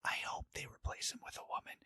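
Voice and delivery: quick, hushed whisper; very soft, voiceless whisper; whispered hope. Real, not acted. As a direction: whisper it like a secret wish.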